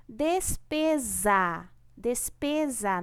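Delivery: "Despesa" drawn out with a clear buzzing Z sound in -pesa; careful teacher's "despesa", voiced z before the final a; slow syllable-by-syllable pronunciation. Shown as a demonstration. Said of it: "não é despesa".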